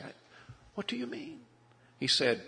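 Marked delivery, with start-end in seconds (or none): 0.7-1.4 high, soft puzzled question; bewildered question. Retold or imitated. Preacher questioning the divorcing man, high bewildered softness. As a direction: ask in quiet shock. Stunned reply to divorce news.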